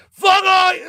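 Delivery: very high, loud outburst; mock drunken shout. Blindly drunk among brothers, pretend high shout. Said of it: "so blindly drunk, you're like".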